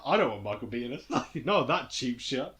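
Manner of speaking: ordinary talk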